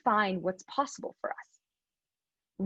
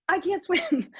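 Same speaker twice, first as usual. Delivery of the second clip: higher, laughing as she admits it; 7 semitones higher, slower than usual. Real, not acted. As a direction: laugh through the confession. Repeats it with a laugh.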